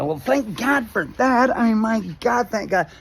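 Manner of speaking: high, loud, mock-desperate thanks; frantic relief. Passenger told he alone survived crash, frantic high relief. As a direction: cry out in hammed-up panicky relief.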